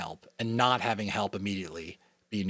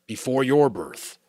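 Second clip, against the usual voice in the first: the giver, explaining; 4 semitones higher, 6 dB louder than usual. Acted. Giver figure speaks.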